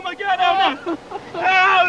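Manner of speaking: normal talking voice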